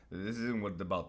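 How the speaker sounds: ordinary talk, nothing marked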